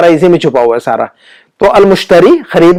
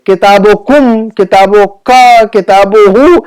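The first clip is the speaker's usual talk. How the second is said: "कुम", "का" and "हु" each held long; drawn-out endings; much slower than usual. Shown as a demonstration. Teacher showing students the attached pronouns, endings held long. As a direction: stretch the last syllable after each "किताबो".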